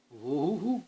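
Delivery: soft high cackling whoop; lightly voiced witch. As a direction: hoot like a gleeful witch. Witch flying off.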